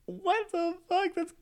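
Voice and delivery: high squealed disbelief, slowed; shocked squeal. Retold or imitated. Speaker reacting to the serial killer, high squeal. Own reaction, campy retold.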